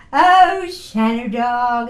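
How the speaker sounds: put-on loud, slow stretched baby talk; calling out dog talk to school friends; silly dog talk. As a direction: draw out Shannon dog like pet talk. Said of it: "So I'd go".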